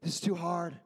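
a hint of a sulky, defeated David; mock complaining. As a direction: sigh it out like a sulking king. David voiced complaining on throne.

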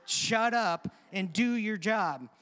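firm, emphatic mock rebuke; blunt command. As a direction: hammer each word as a retold command. Repeats the put-down as blunt command.

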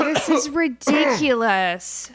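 put-on exasperated kid outburst; fed-up scolding. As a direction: lose patience with a parent. Child fed up with father.

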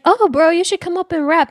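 high, loud hyped-up encouragement; encouraging staff. Retold or imitated. Bartenders voiced in story.

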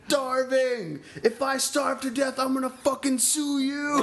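high, hammed-up whiny threat; drunk prisoner. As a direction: play the drunk cellmate threatening to sue. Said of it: "he's like".

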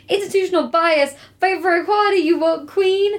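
high-pitched mock rallying cry; five-exclamation-point excitement. Reading own written notes aloud, high exclamatory cheering. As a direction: read your notes like a cheer. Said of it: "Five exclamation points".

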